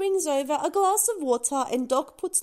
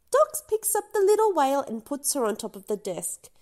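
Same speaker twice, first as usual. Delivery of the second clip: ordinary delivery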